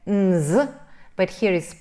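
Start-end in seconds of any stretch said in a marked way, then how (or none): 0.1-0.7 nz hummed and buzzed loudly; voiced ending. Shown as a demonstration. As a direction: voice both sounds in nz. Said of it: "we had the same problem here".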